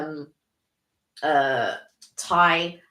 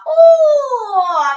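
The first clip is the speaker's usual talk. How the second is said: a high, loud rapturous oh; delighted oh; 13 dB louder than usual. Real, not acted. Guest dreaming of favourite takeaway, rapturous high oh. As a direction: swoon over the dish you're craving. Craving favourite Chinese dishes.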